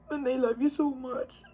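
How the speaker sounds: choking up on the love line; crying. Real, not acted. Sister telling her brother she loves him, crying. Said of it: "I don't know why I'm crying".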